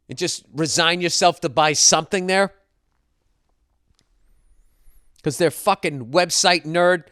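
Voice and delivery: pissed-off rant. Acted. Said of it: "I'm fucking pissed".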